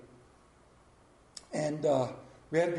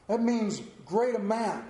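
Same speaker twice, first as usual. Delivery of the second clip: ordinary talk, nothing marked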